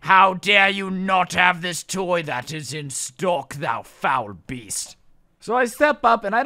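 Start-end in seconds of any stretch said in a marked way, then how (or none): 0.0-5.0 slow, hammed-up theatrical condemnation; mock outraged tirade. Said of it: "had just gotten, like, yelled at".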